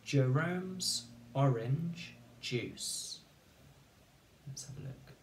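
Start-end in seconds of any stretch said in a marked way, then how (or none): 0.0-3.2 words spaced out slowly for spelling; slow teacher dictation. Shown as a demonstration. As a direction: dictate each word slowly and clearly. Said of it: "Okay, three words".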